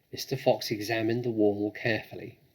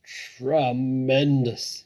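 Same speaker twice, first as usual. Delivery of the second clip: slow, loud stretched shout; drawn-out shout; 3 semitones higher, 9 dB louder, much slower than usual. A narrator's reading. Badger shouts with delight at the cider. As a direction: shout it long and thrilled. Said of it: "shouted Badger".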